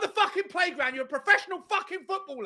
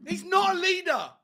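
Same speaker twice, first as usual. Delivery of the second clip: raised, loud outburst about the captain; angry rant; 4 semitones higher, 6 dB louder than usual. Real, not acted. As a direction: rail furiously at the captain.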